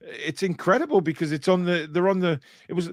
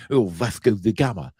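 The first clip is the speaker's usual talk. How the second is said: light imitation of a Portuguese accent on Vasco da Gama; mock Portuguese accent; 5 semitones lower than usual. Mocking Alex putting a Portuguese accent on names. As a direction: tease a colleague by hamming up a Portuguese accent. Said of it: "put the accents on instead of just talking in his normal accent".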